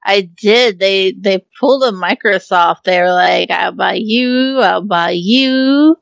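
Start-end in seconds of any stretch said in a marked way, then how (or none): 3.5-5.9 slow, stretched-out imitation of a big spender; drawn-out mock offer. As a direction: drag out each word, mimicking an eager buyer. Mimics company on a buying spree.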